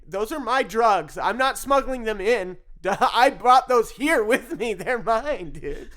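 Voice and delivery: loud, high, drawn-out indignation; indignant inner protest. Retold or imitated. Thinking it at the border officer, loud mock-indignant protest. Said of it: "in my mind, I'm thinking".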